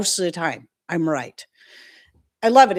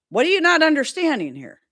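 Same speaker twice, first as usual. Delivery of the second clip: higher, louder retold complaint; exasperated; 8 semitones higher, 5 dB louder than usual. Customer to phone company over repeated bills, exasperated. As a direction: demand it in fed-up disbelief.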